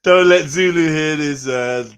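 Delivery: loud mock warning; half-joking loud call. Real, not acted.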